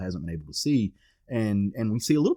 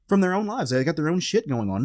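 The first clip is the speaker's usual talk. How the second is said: usual speaking voice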